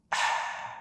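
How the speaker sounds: airy ahh after a cold sip; refreshed sigh. Shown as a demonstration. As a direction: breathe out like after a cold drink. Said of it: "After that first sip".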